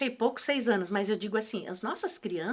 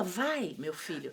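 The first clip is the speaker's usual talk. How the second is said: resigned send-off; 4 semitones lower, 7 dB quieter, slower than usual. Acted. Parent sending son off to play.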